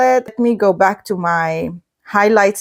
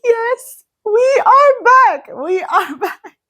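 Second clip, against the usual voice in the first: much higher, squealing with delight, breaking into a laugh; thrilled squeal; 11 semitones higher than usual. Real, not acted. Reader delighted their favourite banter returns, squealing and laughing. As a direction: squeal it like your favourite duo is back.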